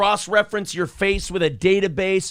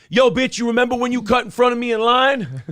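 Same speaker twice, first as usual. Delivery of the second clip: loud, high street taunt; mock street confrontation; 6 semitones higher, 4 dB louder than usual. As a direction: call her out in line.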